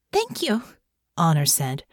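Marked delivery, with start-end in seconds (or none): 0.1-0.6 much higher, slightly squeaky thanks; a little squeaky. Acted. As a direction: nerves pushing the voice up. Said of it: "Her voice came out a little squeakier than usual".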